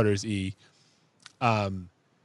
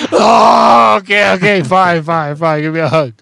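higher, louder gushing surrender; melting dad giving in; 7 semitones higher, 9 dB louder than usual. Retold or imitated. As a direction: give in all at once, overwhelmed. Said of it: "I was like".

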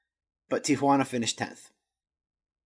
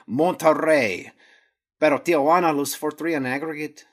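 Spanish accent; 5 dB louder than usual. Shown as a demonstration. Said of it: "Sometimes my Spanish accent's good".

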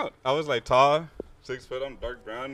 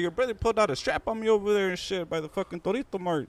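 high-pitched imitation of an aggrieved stranger; retold accusation in a raised voice; accusing; 11 semitones higher than usual. Stranger accuses newcomer over his brother, aggrieved.

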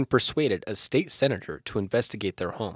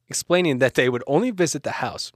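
ordinary talk

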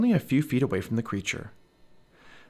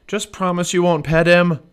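loud, raised-pitch call; 7 semitones higher, 8 dB louder than usual. A narrator's reading. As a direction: call a warning down to someone below. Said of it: "Jack called down to Annie".

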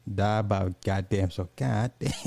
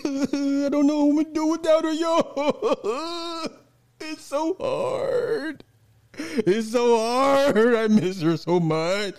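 very high, slow, whiny fake heartbreak; milk it like a broken man crying for sympathy; mock grieving; slower than usual. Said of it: "sad face emojis".

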